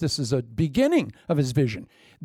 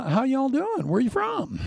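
higher, breezy, teasing voice; playful Joseph; 9 semitones higher than usual. Acted. Joseph teasing his unknowing brothers, playful and high. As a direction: toy with them like an easygoing host. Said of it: "He says, uh".

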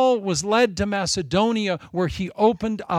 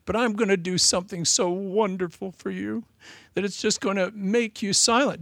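soft, gentle, softer and higher-pitched loving reply; tender voice of God; 4 semitones higher, 4 dB quieter than usual. Acted. God gently answering David's wish to build, tenderly. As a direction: speak to him like a father comforting a child. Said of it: "God so tenderly says".

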